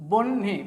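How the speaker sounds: "বহ্নি" split in two, long n then hi; n before h. Shown as a demonstration. Teacher showing how it sounds, বহ্নি with n ahead of h. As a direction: say বহ্নি as "bonn-hi", n held. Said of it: "দন্তন্যর উচ্চারণটা আগে হবে".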